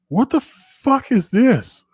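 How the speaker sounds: high, slow, drawn-out disbelief; shocked outburst. Retold or imitated. Wife finding the clogged toilet, appalled and slow. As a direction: stretch it out, appalled.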